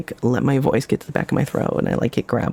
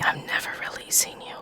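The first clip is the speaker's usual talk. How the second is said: whisper; 10 dB quieter than usual. Pretend. Teasing trapped viewers, whispered.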